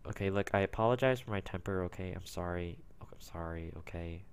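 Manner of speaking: calm down and apologize; quiet gamer apology; sheepish apology. Real, not acted. Apologizing after outburst.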